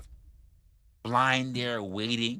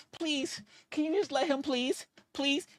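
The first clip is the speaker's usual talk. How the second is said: high, slightly softer pleading voice; soft mock falsetto begging; 4 dB quieter than usual. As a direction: whine it like a desperate prisoner. Imitating the prisoner begging Alex.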